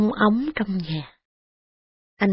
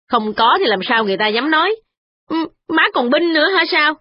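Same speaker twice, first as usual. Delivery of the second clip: fast, higher sniping wife; quick retort; 8 semitones higher, faster than usual. Acted. Wife accusing mother-in-law of taking sides, fast and sharp. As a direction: snap back quickly, accusing.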